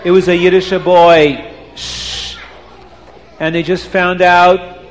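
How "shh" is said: whispered shh; shushing. Real, not acted. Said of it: "hushing the children".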